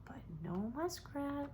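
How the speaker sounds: whispered, breath only; a tense whispered aside; hushed whisper. A narrator's reading. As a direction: whisper it as the hope fades. Tense wait, hushed narration.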